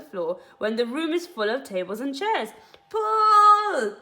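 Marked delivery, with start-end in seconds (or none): 2.9-4.0 high, loud yell. Acted. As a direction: yell it with all your might. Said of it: "yelled Mugglewump".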